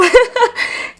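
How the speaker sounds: high laugh through tears; tearful laugh. Real, not acted. Actress overcome while praising co-star, tearful laugh. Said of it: "I'm getting emotional".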